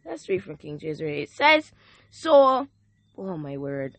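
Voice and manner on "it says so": rising, louder preacher's call to the congregation; full-force preaching. Acted. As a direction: preach it out, lifting the room.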